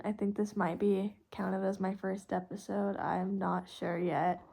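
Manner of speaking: normal talking voice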